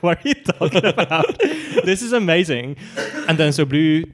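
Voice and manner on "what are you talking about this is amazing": giggling through the words; laughing disbelief. Retold or imitated. Podcast host retelling her reaction, laughing. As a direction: laugh while you say it in disbelief. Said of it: "And I'm like".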